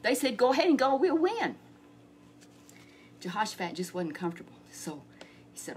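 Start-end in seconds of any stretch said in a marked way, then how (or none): 0.4-1.5 higher, eager go-ahead; eager prophets. Acted. False prophets urging Ahab to battle, eager and high. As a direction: cheer the king on.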